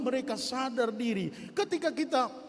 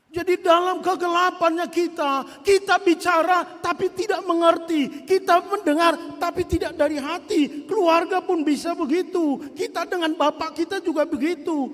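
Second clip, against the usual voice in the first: higher, louder pulpit preaching; full-force preaching; 8 semitones higher, 8 dB louder than usual. Acted. Preacher rousing the congregation with higher, louder preaching. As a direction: drive the point home to the congregation.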